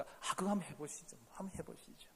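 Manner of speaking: soft, fading to a whisper; mild meek reply. Acted. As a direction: meekly say yes, trailing off. Acting agreeable congregant. (점점 작아지며 속삭이듯 순종하며)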